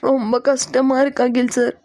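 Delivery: slow, weepy confession; tearful distress. Acted. Distressed girl confiding in Akhil sir, tearful distress. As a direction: speak slowly through acted tears. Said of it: "அழுதுட்டே இருக்காதீங்க".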